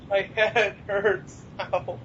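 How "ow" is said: normal talking voice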